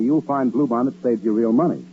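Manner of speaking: normal talking voice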